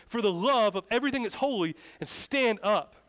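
exasperated yelling. Retold or imitated. Youth pastor begging girl to quit screaming, yelling. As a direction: scold loudly, at the end of your patience. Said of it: "Yelling. I'm like".

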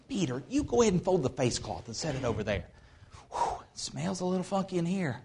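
soft, hushed low voice; sneaking in late. Acted. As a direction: keep it down inside the tomb. Disciples acted unwrapping body quietly.

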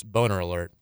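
emotionless robot monotone; flat machine-like alert. Shown as a demonstration. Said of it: "Robot voice".

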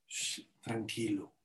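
soft, slow shushing reassurance; hushed calming. Acted. Moshe answers a revolt against him, hushed and calm. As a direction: hush them very softly, slow and low.

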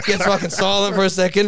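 ordinary talk

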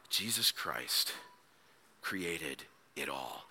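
soft, slow, awestruck; awed hush. Acted. Preacher imagining listeners' awe, hushed and slow. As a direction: let it sink in quietly.

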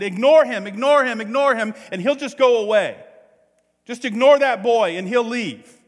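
loud, high-pitched imitated shout; retold yell. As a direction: yell it like the owner across the restaurant. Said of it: "And he yelled out, he said".

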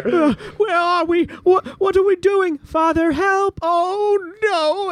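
high, slow, strained whine of panic; panicked villain. Acted. GM voices Berzin waking in hell, high panicked whine. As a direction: whimper in terror at waking in hell. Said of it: "he says".

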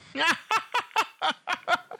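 high-pitched villainous cackle; Kona's cackle. Acted. Game master voices Kona cackling, high cackle. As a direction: cackle wildly, far above your own pitch. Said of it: "Kona cackles".

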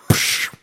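short "psh" punch effect; imitated punch sound. Podcaster replaying the comic punch after the line, imitated "psh". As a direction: make a quick hissed impact noise.

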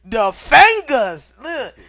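loud, emphatic threat; loud outraged rant. Real, not acted. Guest fed up with pointed fingers, ranting loudly. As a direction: blow up over the finger-pointing.

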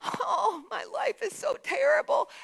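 high, whining mock lament; mock complaint. Pastor mocks a grumbler to his church, high whining lament. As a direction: ham up a moaning complainer. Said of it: "I cannot say".

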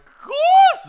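loud, high burst like steam escaping; hammed-up eruption of shock; explosive burning blast. Narrator acting out horseradish hitting, explosive cartoon blast.

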